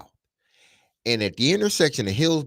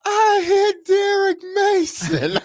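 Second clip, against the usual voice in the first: very high, loud, drawn-out comic wail; mock boo-hooing; 6 dB louder, slower than usual. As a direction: imitate a woman wailing in exaggerated tears. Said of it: "Then she starts boo-hooing".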